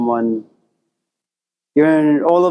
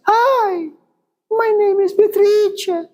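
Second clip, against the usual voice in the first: squeaky falsetto, far higher; very high-pitched voice; slower than usual. Shown as a demonstration. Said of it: "her voice is very, very, very high-pitched".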